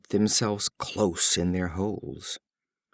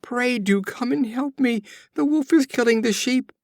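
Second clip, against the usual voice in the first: much higher, louder, desperate cry for help; terrified shout; 13 semitones higher, 4 dB louder than usual. Acted. Shepherd boy begs unheeding neighbors, in a terrified shout. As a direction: scream it like the wolf is right there. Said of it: "shouted in an agony of terror".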